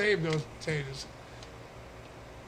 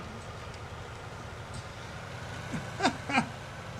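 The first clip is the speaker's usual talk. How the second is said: high breathy laugh; 9 semitones higher, 6 dB quieter than usual. Real, not acted. Laughing at the joke.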